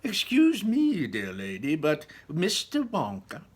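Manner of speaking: high, slow protesting lady; Missus Salt crying out. A narrator's reading. Missus Salt protesting to Wonka, high slow indignation. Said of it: "cried Missus Salt".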